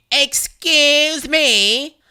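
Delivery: high, loud, very slow impatient demand; brusque impatient. Retold or imitated. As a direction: drag it out like an impatient Karen. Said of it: "a very brusque, impatient lady's voice".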